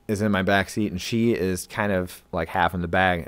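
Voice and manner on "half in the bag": ordinary talk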